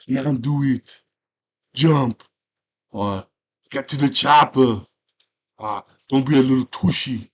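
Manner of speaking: loud, badly faked action-hero accent; hammed-up Conan accent, punched out. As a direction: bark it in a rough attempt at Conan's accent. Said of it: "I can't do his accent".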